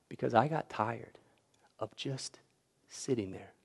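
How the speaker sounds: soft, slow, worn-out reply; quiet tired answer. Retold or imitated. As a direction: say it softly, as if weary of life. Larry's quiet answer to reporters.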